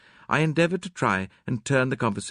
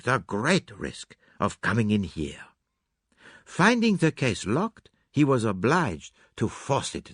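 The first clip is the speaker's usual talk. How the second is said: put-on higher-pitched detective with accented English; Poirot; 4 semitones higher than usual. Poirot explains the theft to companions, accented deliberate reasoning. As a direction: expound it deliberately, leaning on key phrases. Poirot expounds his theory.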